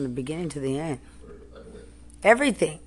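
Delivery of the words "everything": sharp shout. Real, not acted. Angry rant at officials.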